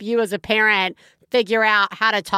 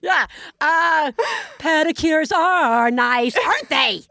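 higher-pitched, drawn-out fake niceness; feigned politeness; 5 semitones higher, slower than usual. Parent to friends at lunch, feigned sweet interest. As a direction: make strained nice small talk, mockingly. Mock small talk with perfect friends.

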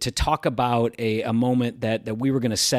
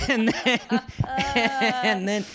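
high, laughing "and then"; giggling while telling it; 12 semitones higher, much slower than usual. Real, not acted. Laughing over the plot twist.

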